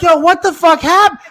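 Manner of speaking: high, loud retold alarm; alarmed demand. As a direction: act out startled disbelief, loudly. Retold reaction to grandmother's thud.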